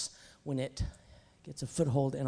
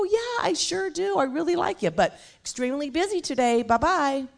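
mock brush-off; 12 semitones higher, 7 dB louder than usual. Acting herself brushing off caller.

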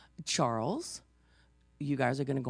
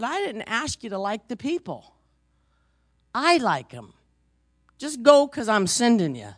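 the Lord answering; 7 semitones higher, 9 dB louder than usual. Retold or imitated. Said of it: "And the Lord said".

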